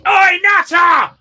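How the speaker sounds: loud, high-pitched drawn-out heckle; shouting horn. Shown as a demonstration. Driver demonstrating a horn that insults other motorists, shouted. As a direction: yell it like a talking truck horn. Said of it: "just get one that goes".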